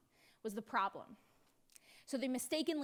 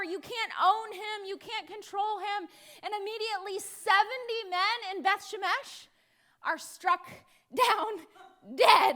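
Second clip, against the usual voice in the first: ordinary delivery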